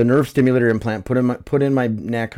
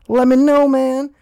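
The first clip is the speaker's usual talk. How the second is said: excited; 5 dB louder, slower than usual. Real, not acted. Excited podcast sign-off.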